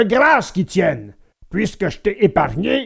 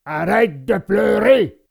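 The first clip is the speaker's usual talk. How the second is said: surly, mildly raised puppet-master voice; gruff scolding shout; 3 semitones higher than usual. A narrator's reading. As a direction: snap at a whining child. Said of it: "cria à Pinocchio".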